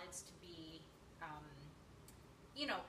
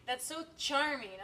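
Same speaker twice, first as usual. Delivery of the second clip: loud, drawn-out mock gushing; slow, emphatic sarcastic praise; 3 semitones higher, 6 dB louder, slower than usual. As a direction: pretend to be charmed, laying it on thick.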